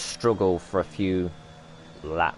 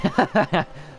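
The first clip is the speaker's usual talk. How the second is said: laughing; 10 semitones higher than usual. Real, not acted. Laughs at crash replay.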